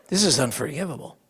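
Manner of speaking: usual speaking voice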